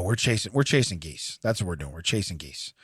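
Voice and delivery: soft, low mock reassurance; quiet mock reassurance. Voicing the company's mock promise.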